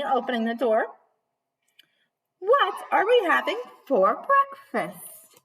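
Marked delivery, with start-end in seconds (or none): 2.4-5.4 high, loud and slow granny voice; cheerful granny. Acted. Granny opening the door, high and cheerful. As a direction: sing it out like a happy old granny.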